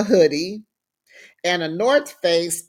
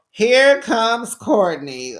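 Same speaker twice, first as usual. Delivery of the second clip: grand entrance; 5 semitones higher, 5 dB louder, much slower than usual. Acted. Announcing Courtney's big entrance.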